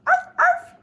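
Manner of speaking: high, loud yappy "arf"; mimicked dog bark. A narrator's reading. Kitten sister teases with chihuahua barks, high mock barking. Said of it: "barked Jujubee".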